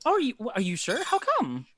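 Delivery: high, hesitant questioning; polite hostess, halting and puzzled; puzzled baker woman. Acted. Baker puzzled at refused pie.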